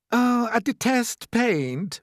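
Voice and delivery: high, slow, fretful spider lady; fretful Miss Spider. A narrator's reading. Miss Spider announcing her hatred of paint, fretful. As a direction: dwell on it in high-pitched distaste.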